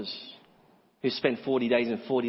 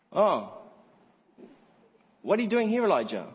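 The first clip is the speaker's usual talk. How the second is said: high-pitched feigned astonishment, falling on Elijah; mock surprise; 7 semitones higher than usual. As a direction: act as if you had no idea he was here. Mocks God as not knowing.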